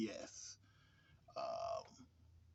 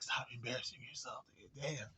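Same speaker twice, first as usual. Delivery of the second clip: dismissive whisper; 7 dB quieter than usual. Retold or imitated. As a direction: breathe it out like a mother rolling her eyes. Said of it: "She was just like".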